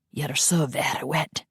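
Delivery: breathy intimate whisper. Acted. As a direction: whisper it close to her ear. Intimate whisper in love scene.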